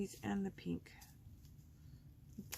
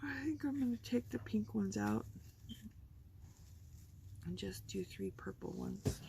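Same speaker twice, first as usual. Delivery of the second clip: whispered aside to herself; 2 semitones higher than usual. Real, not acted. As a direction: hush it like a secret plan. Whispered aside while crafting.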